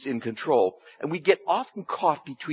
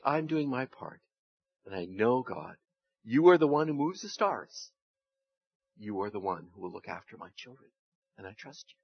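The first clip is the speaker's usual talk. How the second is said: quiet, trusting prayer, fading softer; 4 semitones lower, 6 dB quieter than usual. Acted. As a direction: pray it gently, letting go. Leads a prayer.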